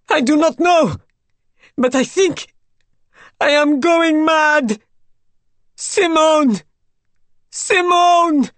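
high, loud hysteria, ending in drawn-out shrieks; rising scream. A narrator's reading. Raoul going mad over Simone, screaming her name. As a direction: scream as if losing your mind. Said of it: "His voice rose to a scream".

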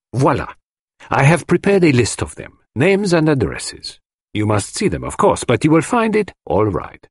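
high-pitched, neatly measured reply; Poirot, assured. Acted. Poirot hands the detective his witness list, confidently. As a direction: present the proof with assurance.

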